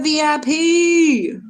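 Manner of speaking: high, loud, slow spelled letters; excited greeting. Real, not acted. Hyping a viewer in chat, high and drawn out.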